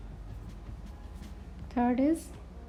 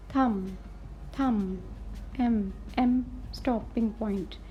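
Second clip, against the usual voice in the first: ordinary talk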